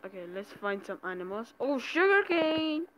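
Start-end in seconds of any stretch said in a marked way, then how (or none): 1.6-2.9 excited. Real, not acted. Sudden find in game.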